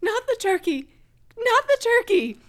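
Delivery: high, loud imitation of a screaming mother. Mother screaming as brother runs in with fire extinguisher, screamed. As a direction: cry out in panic as the mother, pitch way up. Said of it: "my mother screaming".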